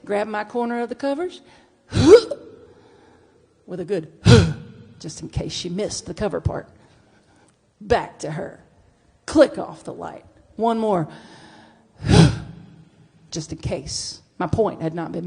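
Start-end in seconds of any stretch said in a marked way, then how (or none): 1.9-2.6 loud, high indignant huff; huffy gasp. Retold or imitated. Imitating her old self to her wife, a loud high huff. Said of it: "It went like this". 4.2-4.9 loud indignant huff; huffy gasp. Retold or imitated. Imitating her old self in bed, a loud huff. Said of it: "It went like this". 12.0-12.8 loud pointed sigh; exaggerated sigh. Imitating her old self turning away, a loud sigh. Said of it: "It went like this".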